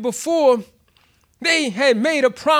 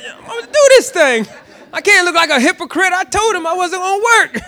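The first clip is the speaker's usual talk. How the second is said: pumping himself up; 6 semitones higher, 4 dB louder than usual. Retold or imitated. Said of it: "I was trying to pump myself up".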